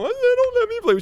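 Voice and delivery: high, whining little-boy voice, mock crying; boy whining to his mom that his brothers won't let him play; whiny child. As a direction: pitch way up like a crying kid tattling to mom. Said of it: "I went crying to my mom".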